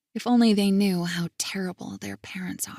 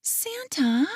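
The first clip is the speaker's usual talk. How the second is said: put-on much higher, let-down child; disappointed child; 9 semitones higher than usual. Girl let down by grandpa's answer, high. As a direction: sound crestfallen like a small girl. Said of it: "her tone of disappointment".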